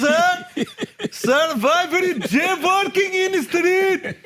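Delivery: put-on Indian accent. Host mocks the moped driver in an Indian accent. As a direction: press him in a hammed-up Indian accent. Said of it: "what he said in his accent".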